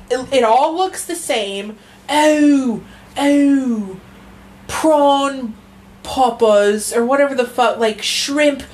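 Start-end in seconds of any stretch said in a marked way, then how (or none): 2.1-6.9 high gushing British imitation; a light British gush; mock British accent. Aping British TikTokers in a high British accent. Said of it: "mocking British food TikTok".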